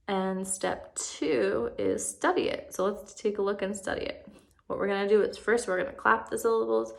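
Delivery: ordinary talk, nothing marked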